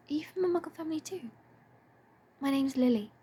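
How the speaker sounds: put-on high, quick, eager young girl; a lightly voiced middle-class girl asking fast. A narrator's reading. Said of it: "She had a high, middle-class accent".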